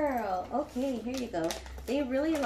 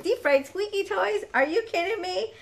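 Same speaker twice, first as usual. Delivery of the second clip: delighted exclaim; 9 semitones higher, 6 dB louder than usual. Real, not acted. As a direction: repeat it higher and louder, thrilled. Repeats name in delight.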